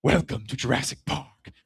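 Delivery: low, soft ominous delivery; menacing whisper of a villain; dad in the dino mask spooking the boys; ominous movie line. Retold or imitated. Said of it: "I turned real slowly".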